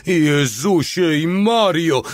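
high, drawn-out wail; howling. A narrator's reading. The little one as the shell explodes, howling. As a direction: howl it slowly, pitch way up. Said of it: "zawył mały".

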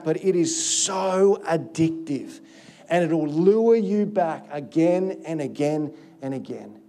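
slow, drawn-out, weighty delivery; slow emphasis. Real, not acted. Preacher warns congregation of sin's lure, slow emphasis. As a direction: let each phrase sink in slowly.

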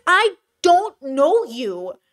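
slow, drawn-out, stunned disbelief. Acted. Creator stunned by a near-stranger's demands, slow disbelief. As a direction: spell it out slowly, as if to a stranger.

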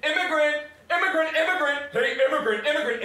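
high, loud imitated taunts; shouting. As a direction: call it out repeatedly like a jeering crowd. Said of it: "people would be shouting".

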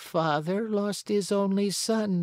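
high, put-on old woman voice; little old woman. Old woman tells Pinocchio of a lost son, high aged voice.

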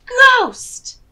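sudden high shout of fright; cried out. A narrator's reading. Teacher shouting the scary twist to her class. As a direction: leap up loud on the last word. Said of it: "Missus Wimple Dimple cried".

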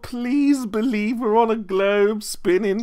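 high, slow mock begging; whiny pleading. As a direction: plead like a whining child. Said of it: "I'll take the crying, the whining, the pleading, the begging".

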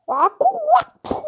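high, loud, drawn-out whoosh noises; imitated throw noises. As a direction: voice the weapon flying with loud mouth sounds. Silah fırlatma sesi taklidi. (ağızla yüksek sesli fırlatma efekti)

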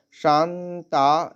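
শান্তা with the first vowel drawn out long, slowly; long vowel demo. Shown as a demonstration. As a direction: stretch the সা in শান্তা. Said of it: "সা দীর্ঘ".

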